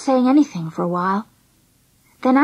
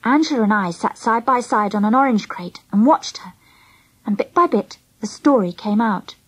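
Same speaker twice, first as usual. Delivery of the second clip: plain reading voice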